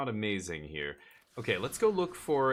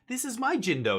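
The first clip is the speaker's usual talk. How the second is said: much higher, louder, proud stranger voice; proud owner; 13 semitones higher, 7 dB louder than usual. Retold or imitated. Stranger showing off his own Jindo, high proud squeal. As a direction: announce it like a thrilled owner. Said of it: "And he's like".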